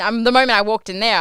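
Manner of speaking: ordinary talk, nothing marked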